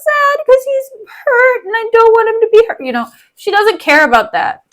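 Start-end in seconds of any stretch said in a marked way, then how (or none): 0.0-2.8 high, whiny mock distress; Bianca. Bianca upset that he's hurt, high mock whimpering. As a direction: exaggerate a tearful high-pitched lament. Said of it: "Bianca's just like".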